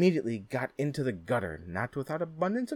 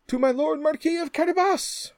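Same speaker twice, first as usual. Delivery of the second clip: reapers' chorus; 10 dB louder than usual. Acted. Reapers answer the king.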